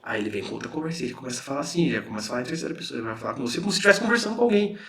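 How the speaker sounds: ordinary talk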